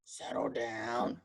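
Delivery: low, slow monster growl; mock roar. Monster teacher orders the class, in a low slow growl. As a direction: drag it out like a grumpy monster. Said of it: "Kirby roared".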